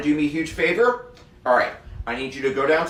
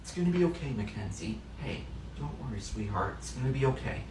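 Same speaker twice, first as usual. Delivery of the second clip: soft, hushed sympathy; tender comfort; 6 semitones lower, 8 dB quieter than usual. Acted. Teacher softly consoling a girl whose grandmother died. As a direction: console a grieving girl gently.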